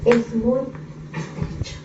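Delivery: estrecho dropped to a whisper. Shown as a demonstration. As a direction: let the last word fade to a hush. Repeats phrase, fading to whisper.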